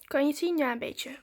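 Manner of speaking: ordinary delivery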